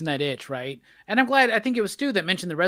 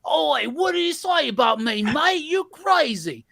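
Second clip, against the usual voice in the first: high, shouty Australian ruffian voice; over-the-top, high-pitched Australian; fake Australian accent; 9 semitones higher than usual. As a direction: yell it in a hammed-up Aussie accent. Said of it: "Speaking of crazy fake Australian accents".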